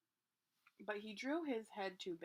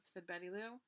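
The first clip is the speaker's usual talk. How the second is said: usual speaking voice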